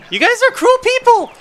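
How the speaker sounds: high, loud outraged cry; mock outrage. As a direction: shriek it in fake horror.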